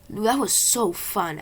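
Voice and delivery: put-on dizzy girl faking that she enjoyed it; fake enthusiasm. A narrator's reading. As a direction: sell an obvious lie. Adeline lying after the ride.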